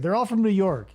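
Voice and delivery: normal talking voice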